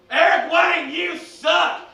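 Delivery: heckler yell. Retold or imitated. A fan heckling the wrestler, shouted. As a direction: yell it from the stands. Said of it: "this guy gets up and he goes".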